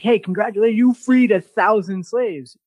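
mildly hammed-up compliment; retold congratulations. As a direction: imitate someone congratulating a hero. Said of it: "when they compliment her on that".

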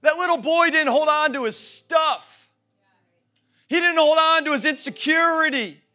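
high, loud, drawn-out declarations; forceful pulpit crescendo; impassioned preaching. Real, not acted. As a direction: drive each phrase home to the congregation. Preacher building to full force.

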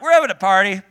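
loud, laughing demand to the cashier; wife and I at the checkout, loudly insisting; pushy checkout demand. Retold or imitated. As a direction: rush the cashier, half laughing. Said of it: "We're like".

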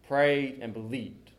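ordinary talk, nothing marked

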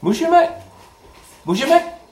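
loud call to the group. Real, not acted. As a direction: raise the voice to get attention. Teacher calling out to class.

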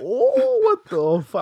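high, loud startled outburst; shocked cry. Retold or imitated. Watching a boy flip off his bike, a shocked yell. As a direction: yelp it out in alarm.

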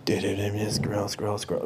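numb scrolling. Retold or imitated. Mimicking teens zombie-scrolling phones.

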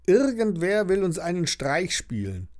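ordinary delivery, nothing marked

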